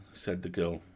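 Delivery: usual speaking voice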